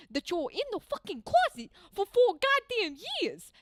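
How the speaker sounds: heated rant slipping into a Boston accent; angry Boston accent rant; Boston accent coming out. Real, not acted. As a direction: get worked up until the Boston accent comes out. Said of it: "You're making me come out with an accent".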